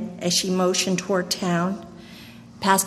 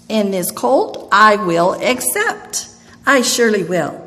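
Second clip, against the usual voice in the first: smiling acceptance; 2 semitones higher, 7 dB louder than usual. A narrator's reading. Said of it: "with a broad smile".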